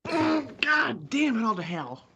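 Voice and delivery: dragged-out groan. Real, not acted. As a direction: drag every word out in dread. Dreading the challenge countdown.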